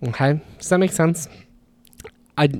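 ordinary talk, nothing marked

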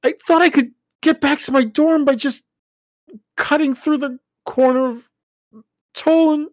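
high, loud, tearful rushing; explaining through tears to parents; tearful explanation. Acted.

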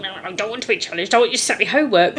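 rattled-off, hurried objection; very fast playful protest; mock protest. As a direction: rush it out like a reluctant pupil. Acting out protest to therapist.

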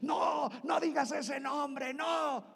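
high, slow mock demon shout; screaming demon. Demon begging deliverance ministers to stop, high shout. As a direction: yell it like a tormented spirit. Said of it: "Y gritan, bien feo".

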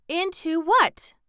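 high, loud, drawn-out demand; insistent question, pitched well up. A narrator's reading. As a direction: press sharply for the answer. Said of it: "demanded Glinda".

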